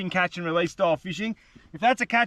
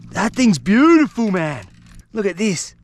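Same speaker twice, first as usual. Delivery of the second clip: excited; 5 dB louder than usual. Real, not acted. Landing the fish.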